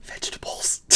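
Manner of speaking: hushed whisper. Real, not acted. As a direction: breathe it unvoiced. Whispered joke reply.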